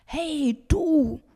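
high, loud hailing shout; forceful two-beat holler across a lake; bellowing. Acted. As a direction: yell to get someone's attention. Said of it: "brüllt Jakob über den See".

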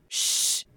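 soft shushing sound; hushing shh. Shown as a demonstration. Teacher imitates asking for quiet, a soft shush. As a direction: hush someone with a shh. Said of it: "if you want someone to be quiet".

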